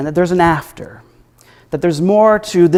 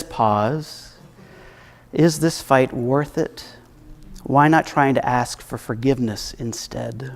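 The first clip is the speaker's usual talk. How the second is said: soft inner voice; 4 semitones lower, 8 dB quieter than usual. Acted. Gut feeling voice urging restraint.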